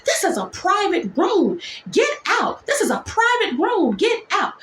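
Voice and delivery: high-pitched shrill order to get out; mock yell. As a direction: shoo her out of the room, pitched high. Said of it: "she turns and looked at her and says".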